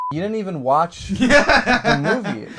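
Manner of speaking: laughing, slow and high; exasperated laugh. Real, not acted.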